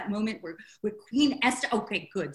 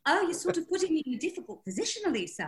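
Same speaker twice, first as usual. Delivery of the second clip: put-on higher, quicker second go at the northern accent; editor's Jon Snow accent; 7 semitones higher, faster than usual. Editor breaking bad news to the author, in imitated northern accent. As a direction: put on her Game of Thrones northern accent, pitched up and hurried. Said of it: "I can only do a cut".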